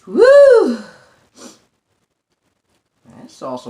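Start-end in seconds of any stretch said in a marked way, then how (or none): 0.1-0.8 a sigh, then a loud high woo from the heat; sigh then whoop. Real, not acted. Reacting to the spicy food.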